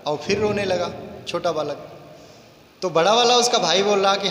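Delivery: plain reading voice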